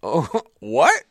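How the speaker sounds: high laughing disbelief; dad laughing in disbelief; incredulous. Retold or imitated. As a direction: react with amused shock. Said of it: "And I said".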